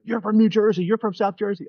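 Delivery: high-pitched, mimicked excited discovery; excited recognition. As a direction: imitate someone thrilled to place an accent. Stranger recognising the accent, retold.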